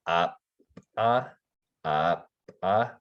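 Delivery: creaky "uh" syllables cut off by a glottal stop. Shown as a demonstration. Teacher demonstrating glottalized syllables, creaky and clipped. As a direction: creak each "uh" and stop it in the throat. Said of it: "So it's kinda creaky".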